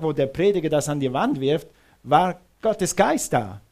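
normal talking voice

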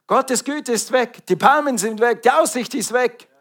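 high, hammed-up dismay inside the tunnel; mock panic. Driver in the tunnel imagining all is lost, mock panic. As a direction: play the traveller losing heart.

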